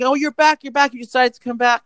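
high, overjoyed welcome back; imitating overjoyed classmates; mock excited welcome. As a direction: gush like you missed him. Students welcome twin back.

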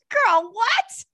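high, incredulous laugh-through; laughing disbelief. Real, not acted. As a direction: crack up on a loud, high "what". Cracking up at the booger.

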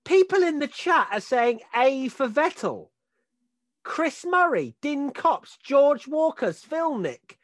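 very high, loud disbelief; incredulous outrage. Real, not acted. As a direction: read out the names in high-pitched indignation. Outraged reading of chat's grades.

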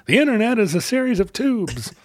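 high, lightly voiced old senator; a hint of a pompous senator; Senator Ted voice. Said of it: "Senator Ted's voice saying".

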